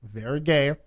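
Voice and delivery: ordinary talk, nothing marked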